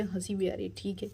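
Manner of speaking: usual speaking voice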